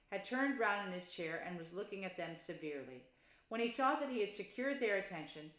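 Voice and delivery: ordinary delivery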